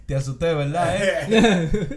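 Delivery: laughing through the words; laughing quote. Retold or imitated. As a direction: tease while laughing. Laughing while quoting prank.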